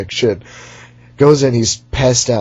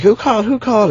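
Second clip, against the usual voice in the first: frantic mock questions; 11 semitones higher, faster than usual. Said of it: "he's like".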